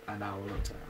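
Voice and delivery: usual speaking voice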